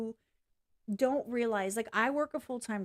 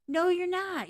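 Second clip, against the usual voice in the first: high, loud flat denial; much higher, louder comeback; emphatic retort; 8 semitones higher, 6 dB louder than usual. Acted. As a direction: shoot it down firmly. Rebutting imagined critics.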